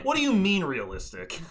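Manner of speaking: exasperated mock argument; incredulous protest. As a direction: throw it back in disbelief. Arguing against the developers' reasoning.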